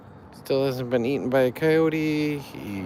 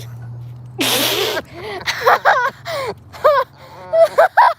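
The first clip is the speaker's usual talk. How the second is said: high, loud shrieking laughter; 9 dB louder than usual. Real, not acted. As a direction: burst into a screaming laugh. Bursts out laughing.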